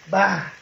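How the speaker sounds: loud, abrupt "bah" for an impact; sudden loud burst; imitated crash. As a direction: mimic the phone smacking the ground. Imitates phone landing by foot.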